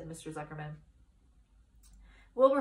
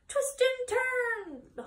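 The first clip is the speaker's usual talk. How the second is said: very high, drawn-out honk; honking goose; 5 dB louder, much slower than usual. A narrator's reading. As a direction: honk the words slowly. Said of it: "honked the goose".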